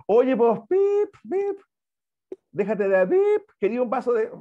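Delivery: crude demo voice with swearing bleeped as a high "pip, pip"; rude vulgar speaker. Shown as a demonstration. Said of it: "eso sería ya vulgar". (imitando a alguien grosero que dice garabatos)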